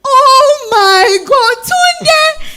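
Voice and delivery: high, loud, slow cry of joy at the door; excited shout. Acted. Surprised woman greeting her old friend Tunde, high joyful shout. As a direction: shriek her old friend's name with delight.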